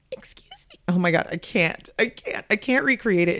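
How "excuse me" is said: very high, tiny, barely audible squeak; ultra-high, whispery timid peep; extra-high timid voice. Shown as a demonstration. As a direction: push it up into a hushed, mousy squeak. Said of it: "it's not even as high as it went".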